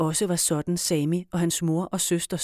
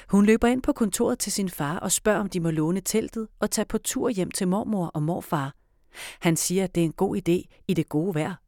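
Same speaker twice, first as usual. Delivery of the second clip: ordinary delivery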